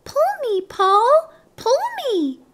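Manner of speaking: tiny, very high tempting voice; high-pitched talking pigtail, coaxing; little pigtail voice. A narrator's reading. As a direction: beg in a little squeaky voice. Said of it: "a little voice coming from the pigtail".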